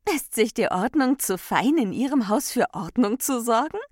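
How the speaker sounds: high, loud, jeering question; taunting. A narrator's reading. Freiheit taunts Ordnung at the party, taunting.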